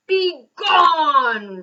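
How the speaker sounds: booming furious roar. A narrator's reading. Bear roars at the mouse to leave, furious. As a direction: roar it out to banish him. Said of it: "roared the bear".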